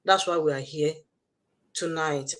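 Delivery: ordinary talk, nothing marked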